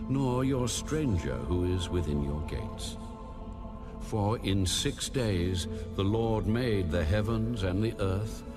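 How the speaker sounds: normal talking voice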